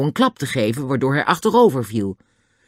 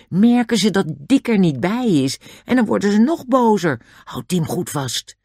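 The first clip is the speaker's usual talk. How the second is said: plain reading voice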